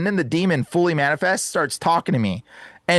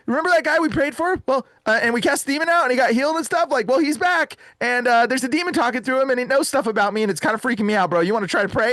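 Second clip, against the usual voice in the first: high-pitched retold phone plea; mildly agitated call for help; freaked out; 6 semitones higher than usual. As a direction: relay it to a friend, rattled and high. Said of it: "So I'm so freaked out".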